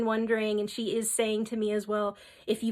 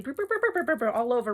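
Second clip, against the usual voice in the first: buzzing dragonfly noise; 3 semitones higher, faster than usual. Retold or imitated. Dragonfly darting about.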